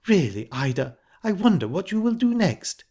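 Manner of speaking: affected shock, pitch swooping high; pretended shock. A narrator's reading. Missus Bryce feigning shock at Ida's suggestion, pitched high. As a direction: act mildly scandalized. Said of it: "pretended to be shocked".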